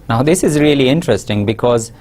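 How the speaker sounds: ordinary delivery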